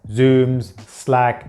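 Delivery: ordinary delivery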